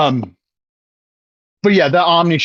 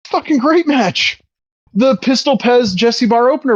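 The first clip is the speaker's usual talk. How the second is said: excited; 8 semitones higher than usual. Real, not acted. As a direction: rave about it. Raving about the match.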